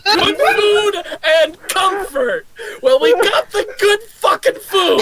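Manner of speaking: high, loud and laughing through it; cry-laughing toast to good food; laughing shout. Acted. Speaks to Bilbo in character.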